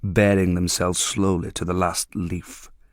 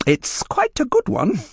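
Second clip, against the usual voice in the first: high, slower, friendlier dealer voice; indulgent dealer; 7 semitones higher, slower than usual. A narrator's reading. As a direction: praise it kindly to close the deal.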